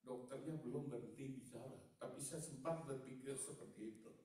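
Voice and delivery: ordinary talk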